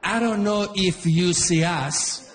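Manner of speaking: high, slow, mock-polite Italian accent; an Italian dad, slow and over-polite; an Italian father confronting the line-cutter, slow and polite; Italian dad politely. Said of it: "my dad".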